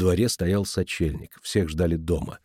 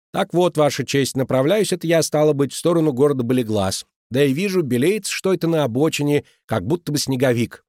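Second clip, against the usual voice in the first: put-on a light Cockney accent, higher and louder; 7 semitones higher, 3 dB louder than usual. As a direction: play a hammed-up Cockney witness. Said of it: "подражать говору кокни". (лёгкий говор кокни, выше и громче)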